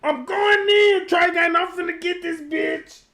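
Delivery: loud, high mock-furious shouting; hyped-up. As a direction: rant it at full volume, all worked up. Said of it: "You could be hyped up in the rave".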